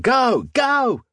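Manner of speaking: high, urgent yell; bellow. A narrator's reading. Lizzie pushing friends into the tunnel, urgent bellow. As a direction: bellow it with pitch shooting up.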